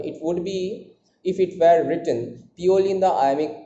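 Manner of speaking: ordinary talk, nothing marked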